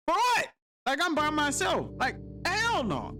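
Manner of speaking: high, indignant "for what?"; retorting to the sergeants in high disbelief; incredulous outburst. Retold or imitated.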